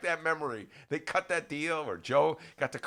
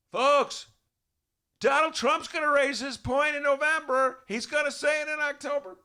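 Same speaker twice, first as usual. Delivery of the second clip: lightly voiced candidate, higher and louder; a hint of a campaign-trail politician warning folks; Tom Steyer impression; 13 semitones higher, 6 dB louder than usual. Host mimicking Tom Steyer's campaign warning, lightly voiced and higher. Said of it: "It's Tom Steyer used to say".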